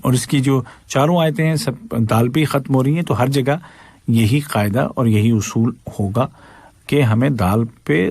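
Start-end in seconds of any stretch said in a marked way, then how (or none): none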